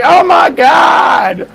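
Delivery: aghast. Retold or imitated. Aunt or uncle reacting to niece's question, drawn-out and aghast. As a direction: drag it out in mock horror.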